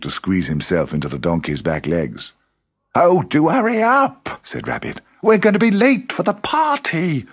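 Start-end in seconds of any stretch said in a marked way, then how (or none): 2.9-4.4 high, slow, fussy Rabbit voice; impatient Rabbit. A narrator's reading. 5.2-7.3 impatient Rabbit. A narrator's reading.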